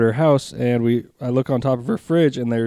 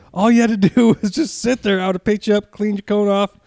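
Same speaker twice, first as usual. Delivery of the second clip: higher, exasperated scolding of the dog; 8 semitones higher than usual. Retold or imitated. Owner scolding the runaway puppy, exasperated. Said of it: "looked at him like".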